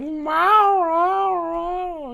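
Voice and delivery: high, playful cat meows. Pretend. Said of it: "and it's like".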